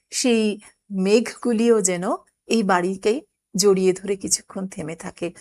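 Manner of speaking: ordinary delivery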